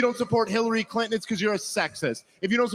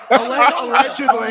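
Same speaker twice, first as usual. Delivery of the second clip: laughing allegedly; 4 semitones higher, 9 dB louder than usual. Real, not acted. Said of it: "joking with a laugh".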